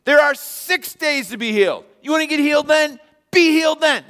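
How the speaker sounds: a furious preacher scolding his flock; outraged preacher. Acted. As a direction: synagogue preacher yelling at the people over the healing. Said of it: "the preacher gets up there and says".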